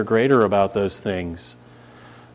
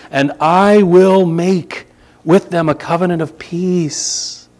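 louder, higher solemn scripture proclamation; preacher reading verses aloud, raised and solemn; 4 semitones higher, 5 dB louder than usual. Acted. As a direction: proclaim the prophet's words from the pulpit. Reads scripture aloud with force.

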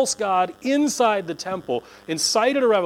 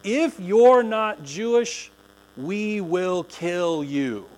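very slow, ominous threat; slow deadly warning; menacing warning sign; much slower than usual. Retold or imitated. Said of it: "signs on this little five-foot wall".

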